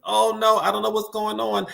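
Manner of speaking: real "oh no" alarm, pitched well up; dismayed. Real, not acted. Host reacting to the connection getting stuck, dismayed. Stream connection keeps cutting out.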